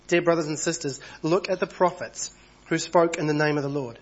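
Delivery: ordinary delivery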